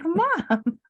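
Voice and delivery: high, laughing "Mom."; half-laughing plea; exasperated mom. Retold or imitated. Daughter to fallen mother, high half-laughing call. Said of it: "but I was like".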